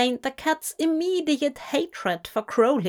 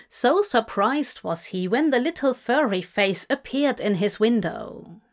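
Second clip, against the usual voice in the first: ordinary delivery, nothing marked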